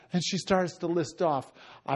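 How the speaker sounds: ordinary delivery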